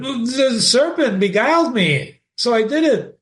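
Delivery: Eve's voice. Acted. Eve voiced in the Genesis retelling.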